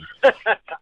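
a short real laugh at the joke; amused laugh. Real, not acted. Laughs at his own accent.

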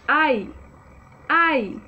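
A and I run together as one short Ai; quick, blended vowels. Shown as a demonstration. Teacher showing the class the joined vowels, quickly blended. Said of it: "Mais rápido".

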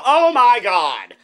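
slow, high, loud gasp; exclamation. Retold or imitated. Vlogger showing how he swears, slow high and loud. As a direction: drag out the "Oh my God". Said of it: "when I go".

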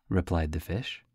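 ordinary delivery, nothing marked

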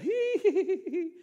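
high, loud squealed giggles; piercing falsetto hee-hee-hee; imitated children's squeal. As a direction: mimic little kids squealing as they flee. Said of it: "And they go".

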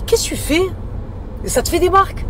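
high, loud, incredulous outburst; retold shocked reaction. Telling viewers how she challenged the pimple-popper, loud shocked disbelief. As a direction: re-enact your appalled protest at full pitch.

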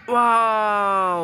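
a very slow, stretched-out wow; drawn-out wow. Real, not acted. Parent marvelling at toddler's pretend shooting, slow stretched wow. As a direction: draw the wow out in mock amazement.

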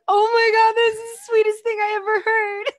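high, loud gushing with a catch of tears; overwhelmed by a sweet gift; gushing, near tears. Retold or imitated. Gushing to the host, high and loud near tears. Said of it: "And I was like".

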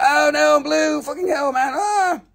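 high, whiny mock lament; distressed talking rock. The boring rock bemoans being blue, squeaky mock distress. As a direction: wail in a squeaky little voice.

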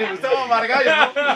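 high burst of real laughter; laughing. Real, not acted. Host cracking up at the joke, laughing. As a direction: laugh through the name.

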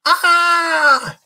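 high loud shriek; creature shriek. Acted. Frightened creature shrieking at the explorers, high and loud. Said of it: "yelled the bunny creature".

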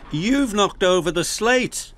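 high, dismayed exclamation; crying out. A narrator's reading. Banksman scolding Ned over slate, crying out. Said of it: "cried his banksman".

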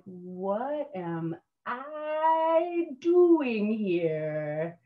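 long, high stretched-out wail of disbelief; drawn-out dismay. Retold or imitated. New recruit in the rocking cattle car, drawn-out dismay. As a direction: stretch it right out, falling on "here".

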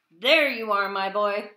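put-on a loud call of "There you are, my boy.". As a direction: call him home from across the yard. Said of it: "they heard a shout".

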